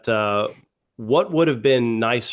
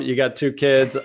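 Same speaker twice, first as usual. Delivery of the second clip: usual speaking voice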